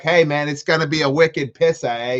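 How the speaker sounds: loud, high mock Boston accent. Imitating a cute girl at the bar, hardcore Boston accent. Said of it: "she starts talking like this".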